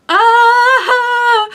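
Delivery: high, loud squeal of anticipation; excited squeal. Real, not acted. Book fan bursting over an upcoming sequel, excited squeal. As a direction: let out a thrilled "Ah!". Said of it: "I'm so excited for this".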